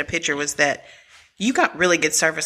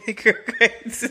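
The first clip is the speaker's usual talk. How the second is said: higher, giggling through GRILL GRATES; laughing; 6 semitones higher, slower than usual. Real, not acted. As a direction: try to say GRILL GRATES while cracking up. Cracks up trying to resume.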